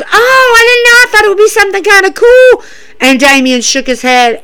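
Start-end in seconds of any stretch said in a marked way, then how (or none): 0.0-2.6 high, loud, oblivious voice; Matt Riddle. Retold or imitated. Matt explains himself to Damien, high-pitched innocent imitation. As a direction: imitate him much higher, gushing innocently. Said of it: "And Matt goes".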